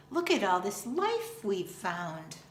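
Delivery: ordinary talk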